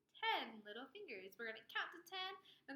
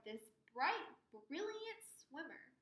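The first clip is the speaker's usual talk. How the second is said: ordinary delivery, nothing marked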